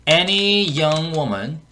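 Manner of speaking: very slow, word-by-word teaching delivery; slow model reading. Shown as a demonstration. Teacher repeats the clip for learners, slow model reading. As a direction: stretch each English word out slowly.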